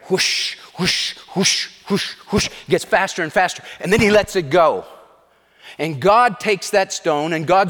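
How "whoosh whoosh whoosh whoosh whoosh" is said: airy, voiceless whooshes; rhythmic breathy swishing noises; whooshing sling. Acted. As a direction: imitate a sling whipping through air. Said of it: "you can hear it as it's whipping through the air".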